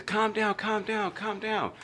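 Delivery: high voiced mother saying calm down; mom calming. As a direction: play the mom settling the kid down. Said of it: "my mom says".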